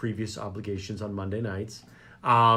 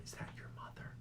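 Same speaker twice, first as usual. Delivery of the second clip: voiceless hushed whisper; 27 dB quieter than usual. Retold or imitated. Woman asking about his mother nearby, secret whisper. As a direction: whisper it so the mother won't hear. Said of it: "someone came up to me and whispered".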